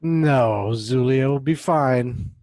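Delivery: loud, slow, drawn-out objection; emphatic refusal. Acted. Zulia answering a teasing new nickname, emphatic refusal. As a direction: turn the nickname down firmly, stretching the words.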